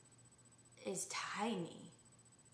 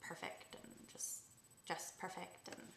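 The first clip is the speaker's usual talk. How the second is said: soft whispered mock of a perfect mom; mock prim whisper; 10 dB quieter than usual. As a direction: breathe "perfect" like a smug mom. Mocking the know-it-all mom.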